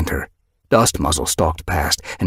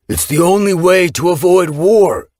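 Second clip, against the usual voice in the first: firm declaration; 11 semitones higher, 7 dB louder than usual. Acted. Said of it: "Gorsefur lifted his muzzle".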